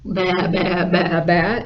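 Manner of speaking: imitated bleats, one after another; steady sheep impression; sheep bleating. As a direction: baa like a sheep. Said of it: "And the sheep went".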